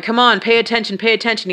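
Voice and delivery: imitating a doctor's insistent prompting; insistent urging. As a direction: urge a drowsy patient to focus. Neurologist urging patient to respond.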